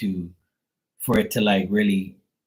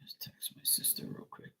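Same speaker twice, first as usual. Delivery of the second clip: whisper; 22 dB quieter than usual. Real, not acted. Aside to chat while streaming.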